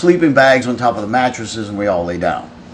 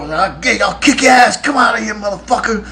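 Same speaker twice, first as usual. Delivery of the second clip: imitating a raging yell, much higher; having a fit; 8 semitones higher than usual. Drunk bandmate raging outside the locked van door, mock furious yell. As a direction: rage and pound at the locked door. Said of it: "[name] was out there having a fit".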